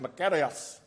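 put-on makarios in a Greek accent, stress on ka. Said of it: "He had that Greek accent".

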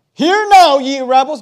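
loud, stern cry of a furious prophet; booming mock rebuke; angry rebuke. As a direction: bark it at the crowd like Moses. Moses shouting at the people.

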